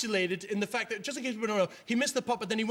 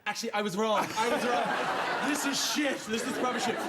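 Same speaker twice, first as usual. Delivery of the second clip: laughing, hammed-up confession of regret; mock Irish lament; 4 semitones higher than usual. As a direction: play a rueful punter admitting defeat. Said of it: "everyone's going".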